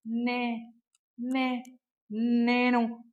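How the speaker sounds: can barely get the word out from shock; trembling stammer. A narrator's reading. Shaken woman stammers after the man dies. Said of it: "నిలువెల్లా వణికిపోతూ అంది సోహిని".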